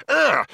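high, loud yelp; startled yelp. A narrator's reading. Arthur spots mice on the table, sudden yelp. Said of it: "suddenly yelped".

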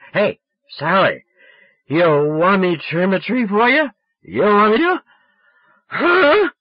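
put-on loud, high drunk pleading; drunken hollering. A narrator's reading. Drunk boy begging Sally to answer, hollering. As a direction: pester her, rising to a squawky huh. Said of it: "Boy, was I drunk".

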